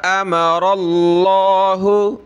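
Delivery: अमरल्लाहु with stretched मा and full heavy अल्लाह; long mim, heavy Allah. Shown as a demonstration. Teacher showing students a drawn mim and heavy Allah. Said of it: "खींचेंगे और लफ्ज़ अल्लाह को मोटा करेंगे".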